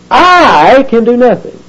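I stretched out long, high and forceful; emphatic I. Shown as a demonstration. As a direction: lean hard on a high, drawn-out I.

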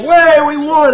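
high, loud whoop of triumph; victory cheer. Acted. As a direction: cheer like soldiers who just won. Victorious army should be cheering.

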